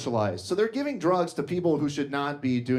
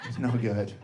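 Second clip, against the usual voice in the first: ordinary delivery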